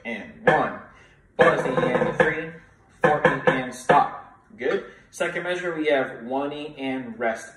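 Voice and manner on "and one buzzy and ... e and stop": louder count with the class; louder rhythmic group count; counting along. Shown as a demonstration. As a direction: lead the class through the bar. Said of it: "Again, ready and go".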